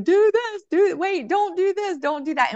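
high-pitched, urgent mock commands; bigger intuitive nudges. Bigger nudges warning us off course, high insistent mock voice. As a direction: pitch way up, like a nagging inner alarm. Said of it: "the bigger intuitive nudges saying".

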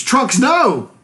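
high-pitched dramatic cry; mock scream. King Cold crying out before he's blasted, mock scream. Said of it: "King Cold screams".

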